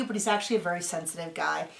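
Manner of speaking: ordinary delivery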